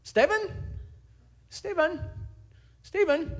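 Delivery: put-on high, loud calls of the name, in a foreign accent; calling through a door. Young partygoers calling the pastor late at night, loud accented calls. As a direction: call Stephen through the door, rising then falling. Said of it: "name sort of butchered in a different accent".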